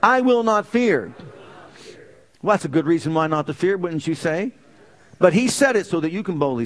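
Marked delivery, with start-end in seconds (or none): 0.0-1.1 bold proclamation. Shown as a demonstration. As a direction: declare it aloud with the congregation. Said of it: "Say it again".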